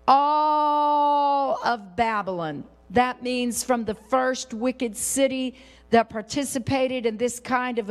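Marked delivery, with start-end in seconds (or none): 0.1-2.6 "all" stretched very long on a held note; drawn-out emphasis. Real, not acted. Preacher declaring judgment on the whole city, "all" hugely elongated.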